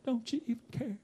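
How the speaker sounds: soft wounded question, barely voiced; a hushed, hurt wife asking if he cares; hushed hurt plea. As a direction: ask it quietly like a hurt wife. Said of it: "and she said".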